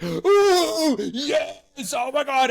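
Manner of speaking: high, loud, squealing delight; delighted frat guys. Retold or imitated. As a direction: pitch way up, thrilled at the shirt. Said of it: "these guys are like".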